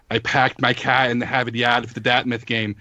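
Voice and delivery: thick, cartoonish Boston accent with dropped r's; over-the-top Boston accent. Son imitating his Bostonian mom, thick Boston accent. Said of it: "my mom's accent is very much".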